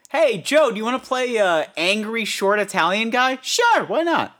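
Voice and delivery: squeaky goofy casting call; high mock voice. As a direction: pitch way up and play it goofy. Voicing casting exchange.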